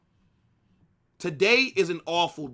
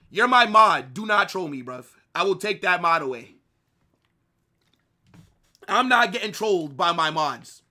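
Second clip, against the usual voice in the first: mock scolding; 2 semitones higher, 7 dB louder than usual. Real, not acted. Scolding a trolling mod.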